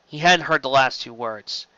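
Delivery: ordinary delivery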